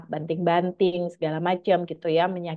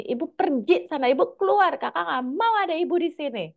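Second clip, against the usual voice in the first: put-on child shouting; 10 semitones higher than usual. Child in a rage yells at mother to leave, high shouting. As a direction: shout like a furious kid who wants mom gone. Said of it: "anak saya teriak jerit".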